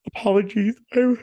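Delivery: tired, high-pitched word through a yawn. Real, not acted. Apologizing to viewers mid-yawn, yawning. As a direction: talk through a real yawn. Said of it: "I'm tired and we lost an hour".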